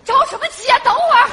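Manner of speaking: high, loud yell through the door; irritated shout. Acted. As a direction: shout back impatiently at the knocker. Shouting back at boyfriend at door.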